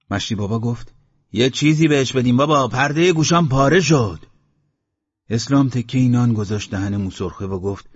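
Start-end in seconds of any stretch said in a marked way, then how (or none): 1.3-4.3 loud, high protest; raised-voice grumbling; exasperated complaint. Acted. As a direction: plead loudly for relief from the noise. Villager complains about the screaming.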